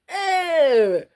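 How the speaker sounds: mock squeal. Squeal at fallen souffle.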